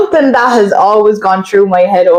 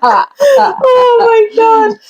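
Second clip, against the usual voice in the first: laughing; much slower than usual. Real, not acted. Cracking up at Dracula.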